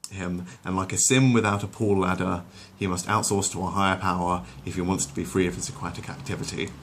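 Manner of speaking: ordinary delivery, nothing marked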